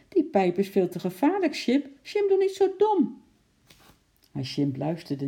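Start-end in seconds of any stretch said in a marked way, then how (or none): 0.1-3.2 put-on raised, frightened child's cry, climbing higher; alarmed warning. A narrator's reading. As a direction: warn your pet urgently. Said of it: "gilde Lotje geschrokken". (geschrokken waarschuwend)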